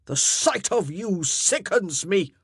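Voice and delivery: loud, slow, contemptuous; disgusted. Acted. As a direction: spit out your revulsion. King's disgust after roar.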